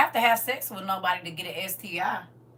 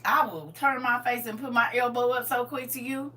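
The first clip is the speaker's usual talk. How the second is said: usual speaking voice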